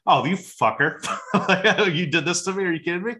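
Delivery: high, loud, laughing disbelief; cracking up mid-accusation; gleeful mock outrage, laughing through it; laughing outburst. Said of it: "And he was like".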